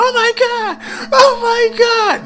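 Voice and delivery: very high, drawn-out mock scream; imitated screaming. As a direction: wail it out, imitating a panicked bystander.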